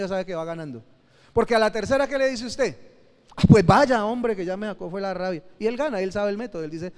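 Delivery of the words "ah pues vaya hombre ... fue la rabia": exasperated outburst. Retold or imitated. Exasperated mother finally snapping.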